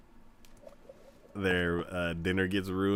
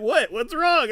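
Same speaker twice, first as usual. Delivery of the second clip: put-on high loud kid's voice asking; little brother; 8 dB louder than usual. Little brother asking what's wrong, high and loud. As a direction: play the confused kid. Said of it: "he's like".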